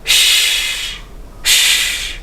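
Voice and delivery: a soft hissed shh; shushing. Shown as a demonstration. Teacher showing how to quiet someone, shushing. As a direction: hush someone gently. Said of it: "When we want someone to be quiet".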